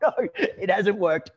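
breathy laugh through mock despair; laughing dismay. Trainer seeing the miner waiting, laughing in mock dismay. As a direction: laugh out the worry. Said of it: "I was like".

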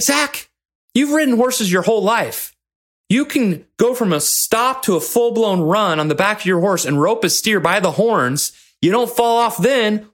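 high-pitched, exasperated retold lecture; frustrated coach. Coach challenging young bronc rider, frustrated wake-up call. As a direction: imitate a coach giving a wake-up talking-to. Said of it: "hadn't usually talked to me that way".

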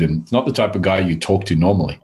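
ordinary talk, nothing marked

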